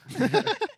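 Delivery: sudden high-pitched laugh. Real, not acted. Laughing at absurd film scene.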